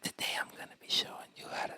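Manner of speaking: quick mock ASMR whisper. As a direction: rush it in a breathy YouTuber whisper. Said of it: "She goes".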